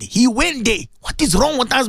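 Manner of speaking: ordinary talk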